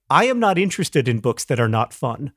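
ordinary talk, nothing marked

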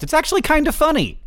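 very high, lilting letter-reading voice; mock delight. Podcast host reading a listener's postscript, in high mock delight. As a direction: pitch it way up in playful delight.

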